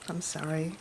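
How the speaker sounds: sigh, then a very soft, choked "I'm sorry"; real tears held back, barely audible; tearful apology. Real, not acted. Apologising to viewers, overcome with real grief over her sister. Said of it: "I didn't expect to get emotional".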